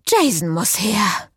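slow, winded gasp; out of breath from the chase. A narrator's reading. Cécile decides they need Jason, gasping. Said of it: "keuchte sie".

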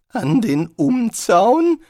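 high, loud, slow disbelieving question; incredulous repeat. A narrator's reading. Rincewind echoing the troll in disbelief, high and rising. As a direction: echo it back in alarm, rising. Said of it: "wiederholte er".

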